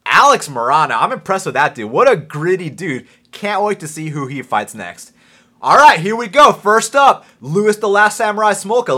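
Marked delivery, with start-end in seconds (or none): 5.6-9.0 loud, raised, punchy show-opener call; announcer intro. Real, not acted. Host bringing on the first guest, loud announcer style. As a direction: announce the fighter with big energy.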